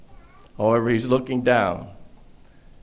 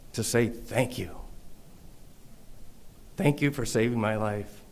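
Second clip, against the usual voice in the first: soft, low, near whisper of thanks; hushed retold thanks; 3 semitones lower, 12 dB quieter than usual. A grateful ex-offender, hushed with emotion. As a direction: lower the voice as if choked up, quietly.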